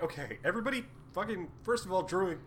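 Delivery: mock bossy rant. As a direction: snap at the group like a fed-up leader, half-joking. Players mock a bossy character.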